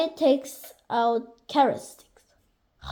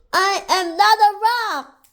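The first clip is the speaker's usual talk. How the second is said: high, loud objection; indignant protest; 7 semitones higher, 5 dB louder than usual. A narrator's reading. Mystery voice protesting to the picnickers, loud high indignation. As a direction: object loudly, stretching the last word.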